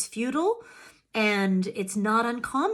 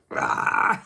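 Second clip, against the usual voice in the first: breathy unvoiced growl standing for full rage; mock roar. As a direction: let out a short animal roar. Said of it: "Not quiet".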